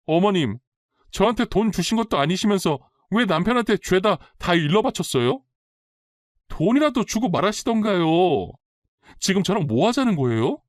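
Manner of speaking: high-pitched indignant accusation; agitated, worked-up. A narrator's reading. Daughter-in-law phones mother-in-law to accuse her of telling, agitated. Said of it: "잔뜩 흥분한 목소리로".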